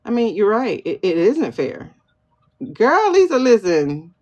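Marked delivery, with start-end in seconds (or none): 2.7-4.1 loud, high call for attention; higher, louder call to a friend. Real, not acted. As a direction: grab Lisa's attention across the room. Calling out to a viewer.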